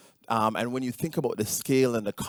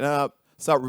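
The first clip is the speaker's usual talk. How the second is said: sharp raised call to stop; emphatic stop; 7 semitones higher, 6 dB louder than usual. Retold or imitated. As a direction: blurt out stop, loud and high. Mock plea to stop watching video.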